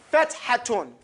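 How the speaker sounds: Fethatun with a breathy h let out; Arabic word Fethatun. Shown as a demonstration. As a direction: model Fethatun for the class to repeat. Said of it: "Say Fethatun".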